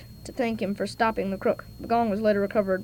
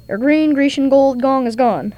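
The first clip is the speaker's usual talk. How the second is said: louder and slow, each G word leaned on; slow emphatic recap; 3 semitones higher, 8 dB louder than usual. Acted. Holmes repeats the client's list, slow and emphatic. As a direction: savour every G word deliberately.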